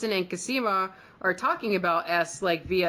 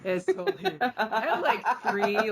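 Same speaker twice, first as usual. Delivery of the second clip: laughing; 5 semitones higher than usual. Real, not acted. Laughing while confessing.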